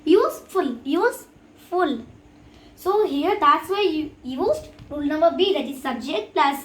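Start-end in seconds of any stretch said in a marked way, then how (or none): 0.1-0.8 USEFUL split clearly as use, ful; teacher counting out use-ful in USEFUL; two syllables. Shown as a demonstration. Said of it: "It is two syllables". 0.8-2.1 USEFUL slowly split as use... ful; slow syllable-by-syllable USEFUL; two syllables. Shown as a demonstration. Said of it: "It is two syllables".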